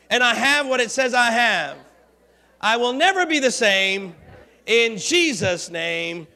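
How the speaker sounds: loud, measured confession for the church to repeat; preaching at full force. Acted. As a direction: lead the declaration with conviction. Congregation confession led loudly.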